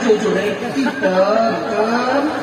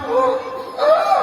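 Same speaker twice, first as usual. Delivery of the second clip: choked sob. Acted. Prophet weeping in retold story.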